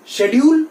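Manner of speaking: "Schedule" as SHED-yool; common schedule. Shown as a demonstration. Teacher accepts the common form, sh onset. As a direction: begin "Schedule" with sh. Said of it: "Schedule is not wrong".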